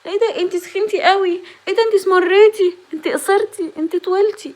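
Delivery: high, loud nosy exclamations building from mild; mocking gossip voice. Vlogger mimicking people commenting on a woman's looks, high loud mock gossip. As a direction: mimic the busybody picking at her body. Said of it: "ولا تهتمي بكلام حد".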